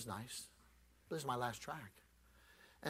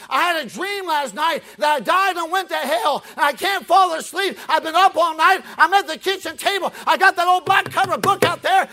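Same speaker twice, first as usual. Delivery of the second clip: loud, higher, furious outburst; mad woman; 19 dB louder than usual. Retold or imitated. Angry woman at the door telling off the preacher. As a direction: let her unload on the stranger. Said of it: "she just got mad".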